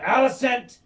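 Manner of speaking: loud, high shout of a name; acted urgent cry; calling out. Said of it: "you can hear him going".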